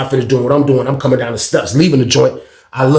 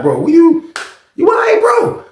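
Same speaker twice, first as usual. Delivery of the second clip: higher-pitched mock incredulous question; retold disbelief; 13 semitones higher than usual. Narrator acts out questioning his bro if he's all right, incredulous. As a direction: voice jumps up, checking on a friend.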